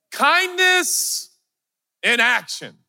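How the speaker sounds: high and slow, stretching each word; very slow, high preaching lift; soaring preach. Acted. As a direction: lift it up like a sermon peak. Preaching virtue list.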